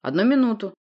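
commanding interruption; cutting them short. Acted. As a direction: stop everyone with a firm call. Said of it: "осадил я всех".

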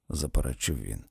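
ordinary delivery

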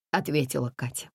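plain reading voice

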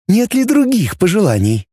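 put-on high, alluring robot girl. A narrator's reading. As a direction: tempt him sweetly. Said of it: "волнующим грудным голосом".

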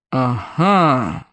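deep, drawn-out gotcha; rumbling bass. A narrator's reading. A policeman catches the runaway, rumbling bass. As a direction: rumble it low. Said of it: "пророкотал чей-то бас".

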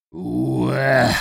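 coughing, spitting grunt; retching grunt. Acted. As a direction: hack it up like a sick hen. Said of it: "проворчала ряба, откашливаясь и отплёвываясь".

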